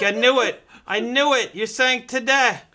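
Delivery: high, loud mock outburst; accusing yell, building to a stretched last word; screaming customer. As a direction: cry it out in hammed-up vindication. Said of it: "I run screaming from it".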